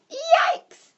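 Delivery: very high, loud yelp of alarm; startled shout. A narrator's reading. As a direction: yelp as if suddenly frightened. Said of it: "shouted Gertie".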